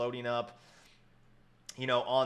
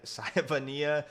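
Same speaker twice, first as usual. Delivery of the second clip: laughing through a name; giggling while saying the name; 3 semitones higher, much slower than usual. Real, not acted. Laughing at own mispronunciation.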